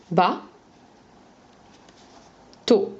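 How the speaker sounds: "ba, to" with a very long pause between; syllabes séparées. Shown as a demonstration. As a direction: dictate "ba, to" as two isolated syllables. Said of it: "donner les syllabes séparées". (« ba, to » avec une longue pause au milieu)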